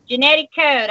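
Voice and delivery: ordinary talk, nothing marked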